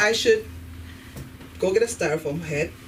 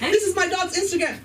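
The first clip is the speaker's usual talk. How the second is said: high-pitched mock dog-owner boast; pushy dog owner; 10 semitones higher than usual. Dog owner showing a stranger the pet's account, pushy mock voice. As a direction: show off the pet, hammed-up and high. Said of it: "every time I meet a dog owner".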